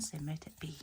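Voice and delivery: hushed, reverent closing words; whispered blessing. Acted.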